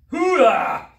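loud high hoo-ah then laugh; effort shout and laugh. Real, not acted. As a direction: yell through the burn then laugh. Shout during plank strain.

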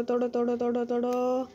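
ordinary talk

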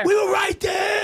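high, drawn-out exasperated yell; exasperated shout. Acted. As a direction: wail it loudly, so close to being free. Exasperated at meeting being prolonged.

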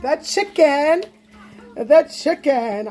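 playful drumroll-style reveal; showy reveal. Pretend. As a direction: present it grandly, drawing out each word. Unveiling the item after announcement.

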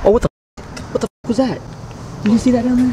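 sudden high startled yelps; jumping at something glimpsed below; startled surprise. Real, not acted. Exploring an abandoned school, startled by movement below, high surprised cries.

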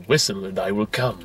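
lightly voiced creepy android; quoting David. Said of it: "shows up behind him and goes".

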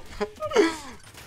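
sudden high laugh, falling; high burst of laughter. Real, not acted. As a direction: laugh out in disbelief. Laughing at the damage.

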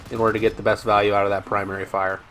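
normal talking voice

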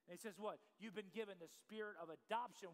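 usual speaking voice

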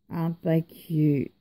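ordinary delivery

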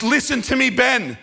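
high, insistent cry; imitated screaming mother. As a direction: demand to be heard, as an upset mother. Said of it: "She's screaming".

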